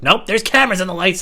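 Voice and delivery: retelling a spiteful reply with a gun to his head; spiteful defiance. Retold or imitated. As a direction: refuse to back down, loud and cocky. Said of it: "I was like".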